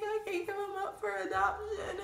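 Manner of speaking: high, squeaky pretend crying, half laughing; mock tearful plea. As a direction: whine it as if bawling. Acting tearful reply to mom.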